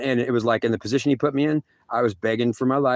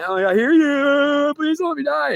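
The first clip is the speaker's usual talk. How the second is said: much higher, small desperate voice; pleading squeak; 14 semitones higher, 4 dB louder, slower than usual. Retold or imitated. God mimicking him begging not to die, very high and small. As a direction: shrink into a tiny begging voice.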